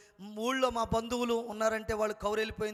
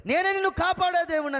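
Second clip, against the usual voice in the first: higher, louder, ringing divine declaration; echoing voice of God; 6 semitones higher, 7 dB louder than usual. Retold or imitated. God's voice echoing in the dying man's ears, loud and high. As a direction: call it out like an echo through a mic. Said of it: "ఈ మైకులో ఈ ఏకో పెడితే ఒక మాట పది మాటలు వినపడినట్లుగా".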